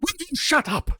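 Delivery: high, exasperated mock snap; irritated retort. Booth telling the chatty Lincoln to shut up, exasperated. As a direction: snap at the noisy guy beside you.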